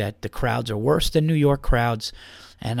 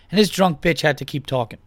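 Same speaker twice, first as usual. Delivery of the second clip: ordinary delivery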